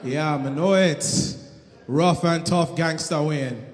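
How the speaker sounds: low, soft mock Jamaican tough guy; bad man Wayne persona. Comic introduces his fake island persona, low mock Jamaican tough voice. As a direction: drop low and growl the patois name. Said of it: "this persona".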